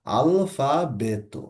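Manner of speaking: very slow "আলফাবেতো", final তো dental, never টো; soft তো ending. Shown as a demonstration. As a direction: spell it out slowly, dental তো. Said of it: "এখানে বলা হচ্exactly তো". (খুব ধীরে "আলফাবেতো", শেষে দন্ত্য তো)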